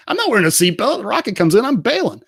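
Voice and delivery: high, lively retelling of himself; mock-defiant, high-pitched protest; animated self-quote. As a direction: swoop up high with comic insistence. Retells his laughing defiance about seatbelts.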